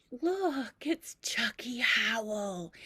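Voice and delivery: frail grandmother spotting someone who isn't there; hallucinating grandmother. Acted. Grandmother pointing at a stool, faint wondering whisper.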